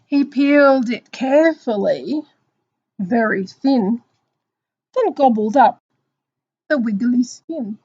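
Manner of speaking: loud, drawn-out picture-book reading; slow down and build suspense for children; story narration. Acted. Teacher reading of the apple's discovery, slow story narration.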